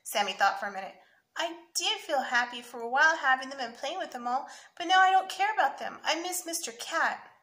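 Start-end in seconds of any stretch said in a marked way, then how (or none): 1.4-7.3 put-on high, loud little-boy confession; lightly acted unhappy kid; plaintive child. A narrator's reading. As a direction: explain it in a raised child's voice. Child confessing he misses his toy.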